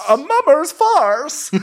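very high, slow echo; mock outrage. Podcaster echoing the insult, mock outrage. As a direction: repeat it high and drawn out, hammed-up.

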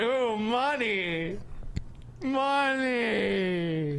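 high, slow, drawn-out greedy coo; greedy mock preacher. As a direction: savour the word money like candy. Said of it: "He starts smiling".

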